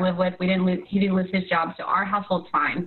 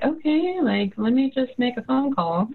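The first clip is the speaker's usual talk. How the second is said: hesitant okay; 5 semitones higher than usual. Retold or imitated. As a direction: act out politely puzzled, pitched up. Retelling her uneasy reaction to delay.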